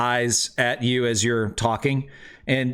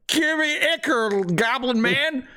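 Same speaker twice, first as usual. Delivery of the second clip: much higher, silly demanding voice; mock adventurers' demand; 11 semitones higher than usual. Game master imitating players accosting the hobgoblin, high mock demand. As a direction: put on a high-pitched mocking voice. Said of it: "walk up to him and be like".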